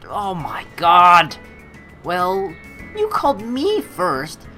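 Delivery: usual speaking voice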